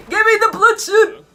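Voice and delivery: high, loud demand with a laugh; excited shout. Real, not acted. Excited viewer demanding the blue chew, shouting. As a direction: holler for it giddily. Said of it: "Sorry, I'm excited".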